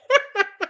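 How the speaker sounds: high giggle; laughing. Real, not acted. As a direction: burst into high-pitched giggles. Laughing at own husband joke.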